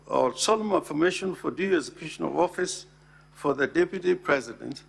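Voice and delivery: usual speaking voice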